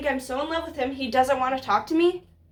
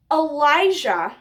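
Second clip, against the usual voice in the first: ordinary talk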